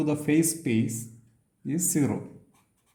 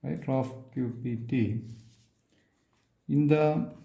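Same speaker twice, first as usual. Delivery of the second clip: very long pauses between short phrases; slow, piecemeal statement. Real, not acted. Lecturer stating a theorem to students, slowly and piece by piece. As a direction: dictate it slowly, a few words at a time.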